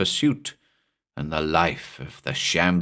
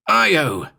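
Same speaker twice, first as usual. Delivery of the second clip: even higher, loud urgent call; yelled name again; 9 semitones higher, 5 dB louder than usual. Acted. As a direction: shout a warning to the dog. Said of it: "I yelled again".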